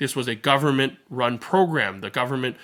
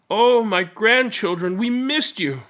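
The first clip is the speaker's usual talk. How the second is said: higher, slow, lightly voiced grandma overjoyed; joyful grandmother; 9 semitones higher, 5 dB louder, slower than usual. A narrator's reading. Grandmother greeting her grandchildren home, slow joyful and higher. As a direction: greet them like a grandma who missed them.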